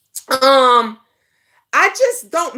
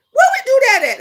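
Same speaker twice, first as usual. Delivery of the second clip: louder, higher challenge; 9 semitones higher, 4 dB louder, much faster than usual. Acted. Repeated rhetorical challenge.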